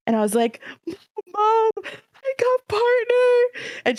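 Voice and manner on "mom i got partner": high, slow, tearful crying voice. Retold or imitated. Calling her mom about the partner news, through tears. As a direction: sob it out, voice breaking high. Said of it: "I'm hysterical crying at this point".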